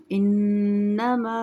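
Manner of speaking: इन्नमा with the doubled न held long and nasal; slow recited इन्नमा, nasal नन held; full ghunna. Shown as a demonstration. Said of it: "ये हम पूरा अदा करेंगे".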